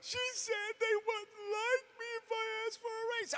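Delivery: high, soft, tearful whimper; mock crying. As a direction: imitate her wailing through tears. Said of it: "she was actually crying".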